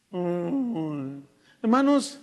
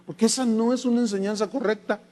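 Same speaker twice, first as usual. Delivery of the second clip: ordinary talk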